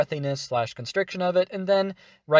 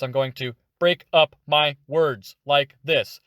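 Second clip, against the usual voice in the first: slower, chopped word by word; broken up words; 5 dB louder than usual. Shown as a demonstration. Tutor contrasting speech patterns, slow broken-up delivery. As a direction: break the sentence into separate beats. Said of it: "So rather than speaking like this".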